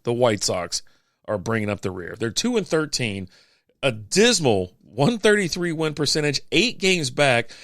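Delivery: normal talking voice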